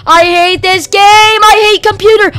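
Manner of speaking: real gamer rage outburst; frustrated rage. Real, not acted. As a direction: lash out at the game in exasperation. Raging at the game.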